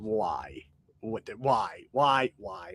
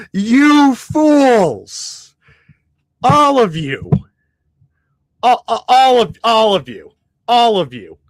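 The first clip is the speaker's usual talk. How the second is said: loud villainous rant; megalomaniac rant; 3 semitones higher, 6 dB louder than usual. Acted. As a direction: bellow it like a mad overlord. Ranting at the team's front office.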